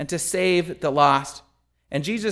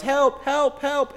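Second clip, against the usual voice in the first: high-pitched cry for help; acted yelling; 9 semitones higher, slower than usual. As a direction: call up out of a pit, pitched well up. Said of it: "And so he yells".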